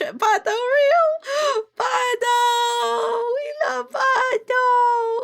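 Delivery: high, loud, slow squealing cheer; excited cheer. Real, not acted. Streamer cheering with chat for her character Pato. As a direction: cheer him on with glee.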